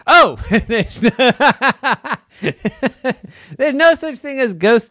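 loud, high, cracking up mid-line; laughing. Real, not acted. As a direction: burst out laughing while speaking. Narrator cracks up while voicing disbelief.

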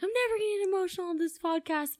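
high, fast, breezy mock of her own old boast; mock past self. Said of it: "I was like".

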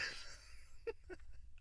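high, airy burst of laughter; a breathless high giggle; high breathy laugh. Real, not acted. High breathy laugh at his own cussing problem.

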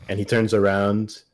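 ordinary talk

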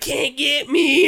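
slow, laughing singsong taunt. Retold or imitated. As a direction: tease him from out of reach.

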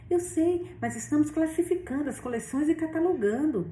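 ansioso, mais agudo, se defendendo; defenda-se com pressa nervosa; defesa ansiosa. A narrator's reading. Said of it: "defendeu o homem ansioso". (homem ansioso se defende da tia, tom mais agudo)

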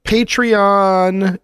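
usual speaking voice

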